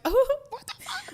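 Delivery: high, loud shocked reaction; high-pitched, exaggerated disbelief; mock shock. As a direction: act out being startled by sudden yelling. Acts out reaction to livestream yelling.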